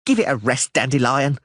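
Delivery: halfling brushing off the bard, mildly curt; light snap. A narrator's reading. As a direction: cut him short, irritated. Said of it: "Dainty snapped".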